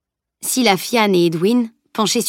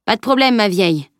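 usual speaking voice

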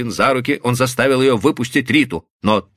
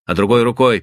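usual speaking voice

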